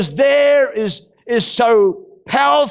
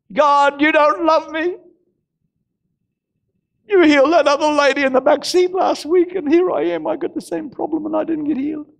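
self-pitying complaint; 4 semitones higher than usual. Retold or imitated. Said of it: "basting ourselves in self-pity".

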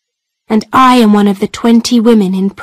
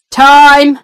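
loud, higher curt call; guard calling time; 5 semitones higher, 5 dB louder than usual. Acted. Guard ends the prison visit, loud curt call. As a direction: cut the visit off sharply.

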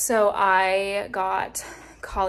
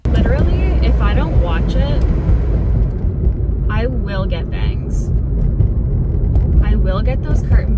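loud; 11 dB louder than usual. Real, not acted. Loud ranting about bangs.

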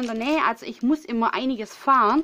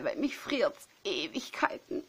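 whispered complaint; 8 dB quieter than usual. Real, not acted. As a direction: whisper it, voiceless and soft. Complains she is freezing.

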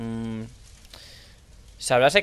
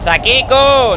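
loud high call across the house; 8 semitones higher, 7 dB louder, much slower than usual. Real, not acted. Searching the dark house, calling loudly for Sakiko. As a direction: shout the name to find her.

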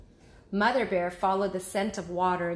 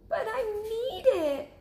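high, whiny little cub pleading; insistent cub; 3 dB louder than usual. Acted. Cub insisting to mother he needs the moon, whiny. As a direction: whine like a cub who won't give up. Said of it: "insisted Baby Bear".

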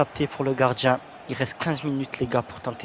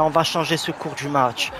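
ordinary talk

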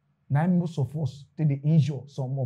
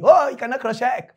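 mock insult; 7 semitones higher, 4 dB louder than usual. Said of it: "they insult me".

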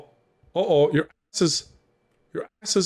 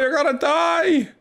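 shouting; 12 semitones higher than usual. Real, not acted. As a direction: yell the warning. Warning friend mid-game.

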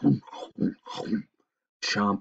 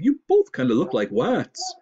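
higher, loud, lisping moose; 8 semitones higher, 6 dB louder than usual. A narrator's reading. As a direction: lisp it loudly through a cold. Moose lisps rats as wats.

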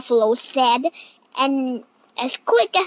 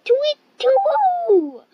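very high, drawn-out hoot; long, high owl call; 14 semitones higher, 4 dB louder, slower than usual. Acted. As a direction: hoot slowly like a startled owl. Owl hoots before fleeing.

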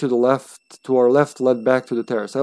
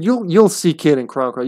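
ordinary talk, nothing marked

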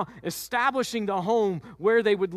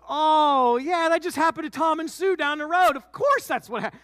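high, loud mocking disbelief; sarcastic Joseph; 9 semitones higher, 5 dB louder than usual. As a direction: shout the sarcasm right back at her. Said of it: "To which Joseph goes".

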